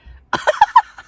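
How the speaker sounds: high, loud squeal of a laugh; burst of laughter. Real, not acted. Laughing at the cashier who won't buy the chicken, loud high squeal. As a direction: let out a sudden delighted shriek of laughter.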